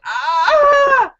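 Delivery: high, loud imitated wail of despair; anguished cry. Devastated player after losing the game, loud anguished wail.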